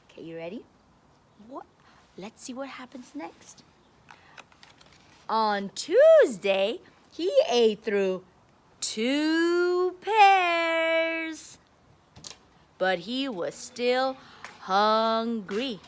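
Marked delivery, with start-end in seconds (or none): 5.3-15.8 emphatic storybook reading, slow and loud in places; reading to children. A narrator's reading. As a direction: read it out big for a class of kids. Reading picture book to kids.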